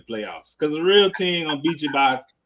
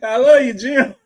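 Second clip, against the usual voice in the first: laughing, higher and louder; 8 semitones higher, 7 dB louder than usual. Real, not acted. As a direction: blurt it out through real laughter. Laughing hard after a joke.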